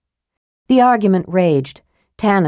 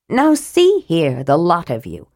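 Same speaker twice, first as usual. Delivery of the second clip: a hint of a stout matron taking charge; Marita scolding. A narrator's reading. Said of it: "said Marita".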